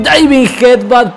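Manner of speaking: stretched, rising move call; wrestling commentator. Real, not acted. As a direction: announce the move slowly and big. Calling the move name. (narrador de lucha anunciando el movimiento)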